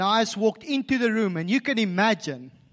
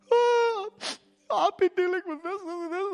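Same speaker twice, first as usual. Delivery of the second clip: much higher, breaking with tears; tearful confession; 13 semitones higher than usual. Retold or imitated. A person moved to tears telling the one who prayed, crying. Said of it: "or even in the moment starts crying".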